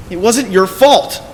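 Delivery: slow anguished outburst; anguished insistence. Acted. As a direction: shout it slowly to convince yourself. Repetition rising to a cry.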